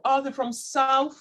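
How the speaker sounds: ordinary delivery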